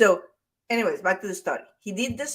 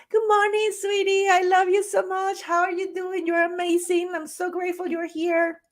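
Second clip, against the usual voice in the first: much higher, sugary voice cooing to the water; loving baby talk; 12 semitones higher than usual. Retold or imitated. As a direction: coo like talking to a baby. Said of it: "talking very lovingly".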